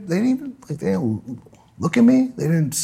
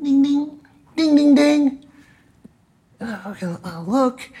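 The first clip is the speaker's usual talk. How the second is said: ordinary talk, nothing marked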